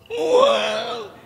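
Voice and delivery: high, loud roaring bear call. Shown as a demonstration. Teacher shows children a bear's loud voice. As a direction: belt it out like a big bear. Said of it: "Suaranya seperti itu".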